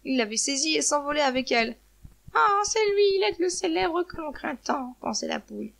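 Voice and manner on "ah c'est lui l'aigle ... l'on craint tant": high, loud gasp of recognition slowing down; horrified hen. A narrator's reading. Hen recognizes the feared eagle, horrified. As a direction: cry out in dread, then draw it out. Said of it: "Avec horreur".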